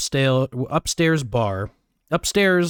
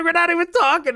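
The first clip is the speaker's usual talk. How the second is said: incredulous; 13 semitones higher than usual. Retold or imitated. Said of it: "I was like".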